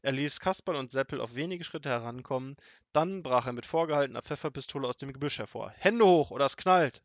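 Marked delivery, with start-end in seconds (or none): 5.8-7.0 loud, high robber shout; robber's holdup shout. A narrator's reading. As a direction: bark the holdup order. Robber ambushes boys. (lauter Überfallruf des Räubers)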